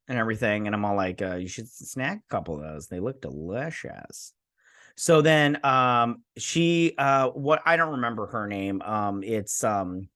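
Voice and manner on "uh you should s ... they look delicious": sly low suggestion. Retold or imitated. Mock advice to Jade in recap.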